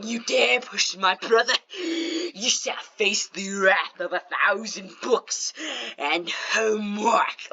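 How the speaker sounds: slow, grandiose mock god voice; mock Thor. Brother as Thor threatening the kid, mock Thor. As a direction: proclaim it like a hammed-up superhero threat. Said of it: "became Thor".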